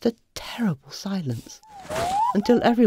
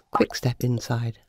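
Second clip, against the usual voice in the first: Quick, step inside said lower and flatter; lower second reading; 6 semitones lower than usual. Shown as a demonstration. Narrator rereading the notice, lower. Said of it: "Or was it".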